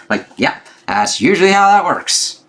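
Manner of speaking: a high mock-agreeing quip; sarcastic aside. Real, not acted. Sarcastic comment on the quote.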